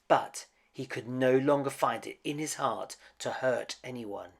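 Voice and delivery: ordinary delivery, nothing marked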